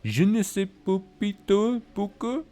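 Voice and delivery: high hammed-up French accent; mock French accent. Streamer reading chat's typed-out French accent aloud. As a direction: read it like a cartoon Frenchman. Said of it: "Typing out an accent".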